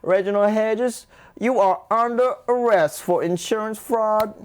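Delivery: high, slow Southern drawl; hammed-up Southern lawman making an arrest; shaky amateur attempt at a Southern US accent; American Southern accent. Shown as a demonstration. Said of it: "I can do, like, a South, American South one".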